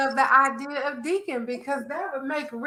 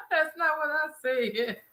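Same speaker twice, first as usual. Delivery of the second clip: laughing; 8 dB quieter than usual. Real, not acted. Caught by friend's teasing.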